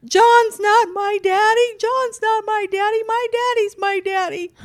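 hammed-up bawling little boy; mock screaming child. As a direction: imitate a kid screaming through tears. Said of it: "was screaming, crying".

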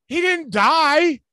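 high, loud exasperated rant; incredulous rant. Real, not acted. Ranting at crying coach quote.

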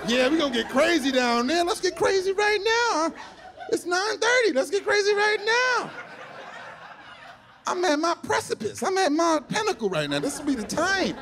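put-on high, hyped party girl; rowdy party animal. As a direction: gush about going wild tonight. Girl voiced hyped for night out.

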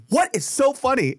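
high-pitched indignant demand; mock exasperated teacher. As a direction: confront a giggling pupil, imitating the teacher. Teacher confronting laughing student.

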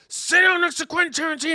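high, loud urging; mock-eager friend insisting; imitating John. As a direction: push him toward the seat. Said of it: "John was like".

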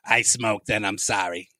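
slow, low, put-on smoker voice. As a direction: croak it like an old smoker confessing. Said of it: "I sound like a smoker".

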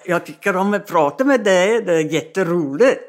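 Swedish spoken on request, with a little laugh; lightly amused Swedish line for the host; speaking Swedish. Shown as a demonstration. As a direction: say it in easy native Swedish. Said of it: "will you say something in Swedish".

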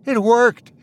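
high, teary disbelief; lightly weeping old man; through tears. A narrator's reading. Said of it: "through his tears".

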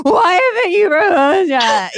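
high, loud mock-sobbing wail; hammed-up crying plea to her boyfriend; mock ugly crying. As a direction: wail it through fake tears like a breakdown. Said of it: "ugly crying".

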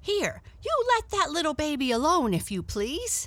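high, loud and quick, a cat scolding a dog; cat snapping at a barking dog to leave the baby; sharp cry. Acted. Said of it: "and cried in cat and dog language".

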